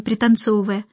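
ordinary delivery

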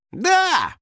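high, eager shout; squeaky bright yell; calling back. A narrator's reading. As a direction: answer from the back seat. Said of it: "откликнулся финик с заднего сиденья".

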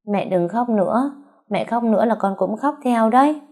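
lightly voiced weak child; weak little boy. A narrator's reading. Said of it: "Nó cố nói, giọng vẫn còn yếu".

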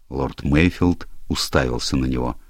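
ordinary delivery

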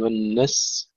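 والنس ending on s, no l; dropped lam. Shown as a demonstration. Teacher showing the mistake, final lam missing in والنس. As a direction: wrongly cut off والنس after the س. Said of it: "وأن تظهر اللام أيضًا".

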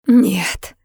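crying, drawn-out moan; wailed. A narrator's reading. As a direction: wail it in anguished denial. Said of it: "взвыла Саша". (горестный вой сквозь плач)

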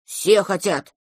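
mild grumble of a displeased little dragon; a touch of grumpiness; understated sulky retort; grumbling. A narrator's reading. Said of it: "буркнул дракончик".

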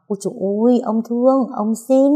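pleading indulgently with a grandchild; coaxing, flattering. A narrator's reading. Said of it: "Ông nội nịnh nọt".

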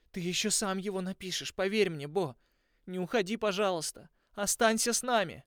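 high, loud, pleading through tears; tearful pleading. Acted. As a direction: beg him to stay, crying. Слёзы Тикаани падали. (плача, умоляюще)

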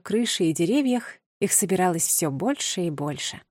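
usual speaking voice

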